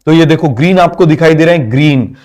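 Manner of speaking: ordinary talk, nothing marked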